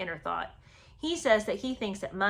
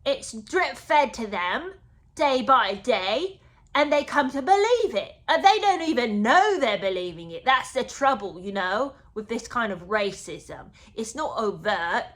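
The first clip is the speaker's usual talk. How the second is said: mock earnest Harry; 6 dB louder than usual. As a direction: lecture the brother like a wounded prince. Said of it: "And Harry said".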